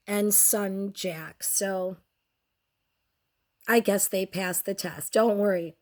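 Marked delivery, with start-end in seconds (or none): none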